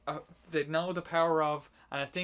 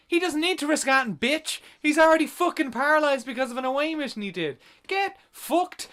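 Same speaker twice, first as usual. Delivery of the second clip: much higher and louder, fast, swearing at her; furious rant; 11 semitones higher, 9 dB louder than usual. Retold or imitated. Yelling back at the film's argument, furious. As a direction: tear into her in outrage. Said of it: "And I was just like".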